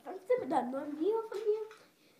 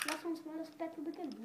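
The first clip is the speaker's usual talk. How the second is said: normal talking voice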